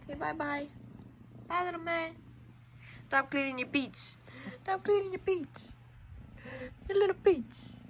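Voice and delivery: soft, high cooing to kittens; pet talk. Real, not acted. Saying goodbye to the kittens.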